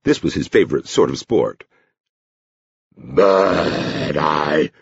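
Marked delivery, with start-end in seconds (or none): 3.0-4.7 drawn-out doggy whine; talking dog. A narrator's reading. Dog admits to the girl it can't bet, drawn-out dog voice. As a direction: draw each word out like a talking hound. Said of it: "he added".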